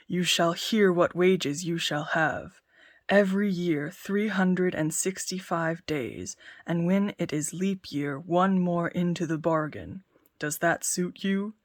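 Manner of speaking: lordly giant offering a job. Acted. Giant speaks.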